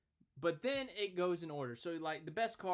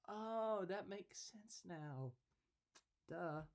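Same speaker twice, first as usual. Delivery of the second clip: drawn-out oh, then slow and soft; self-mocking, stretched-out realisation; mock dawning realisation; 5 semitones higher, 4 dB quieter, much slower than usual. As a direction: play up a slow penny-drop. Acting out his own late realisation.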